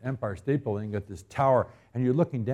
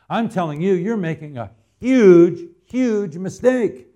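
higher, louder pulpit preaching; full-force preaching; 6 semitones higher, 6 dB louder than usual. Acted. Pastor pressing the congregation on prayer, preaching hard. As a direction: drive each question home to the pews.

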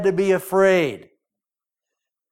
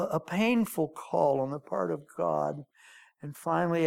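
usual speaking voice

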